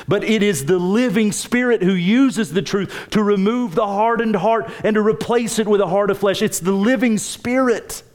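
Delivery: high-pitched, insistent sermon peak; urgent pulpit proclamation, pitched high; fervent preaching. Real, not acted. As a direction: proclaim it to the whole congregation.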